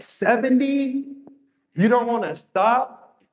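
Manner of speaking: high, slow disbelieving question; incredulous. Retold or imitated. Reacting to a trader who bought seventy accounts, high slow disbelief. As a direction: stretch it out in mock disbelief.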